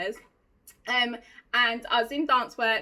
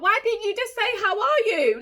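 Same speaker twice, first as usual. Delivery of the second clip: high, loud exasperated question; 9 semitones higher, 6 dB louder than usual. Retold or imitated. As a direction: demand it in raised-pitch disbelief. Retells her exasperation at slang.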